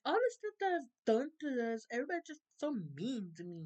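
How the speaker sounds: hammed-up self-pity, only lightly voiced; mock offended Phyllis. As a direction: mimic her playing the wounded one. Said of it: "Phyllis was acting all, um, offended and stuff".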